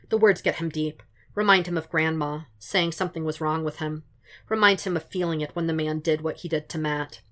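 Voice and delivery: plain reading voice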